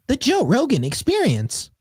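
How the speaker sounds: high, slow whimsical troll. Retold or imitated. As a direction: drawl it out like a playful troll. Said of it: "it's more whimsical. It's like a troll".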